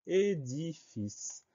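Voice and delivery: "édifice" slowly drawn out as é, di, fice; syllable by syllable. Shown as a demonstration. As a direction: dictate "édifice" in slow, measured syllables. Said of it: "Première syllabe é".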